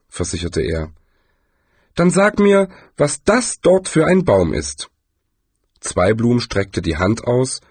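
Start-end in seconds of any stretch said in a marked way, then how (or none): 1.9-4.9 much higher, eager tourist voice; Zweiblum's challenge. Acted. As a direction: test him with a bright, high question.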